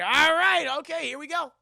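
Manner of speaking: high, loud outburst; worked-up reaction. Retold or imitated. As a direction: blurt it out in mock alarm. Acted reaction to rocking chair.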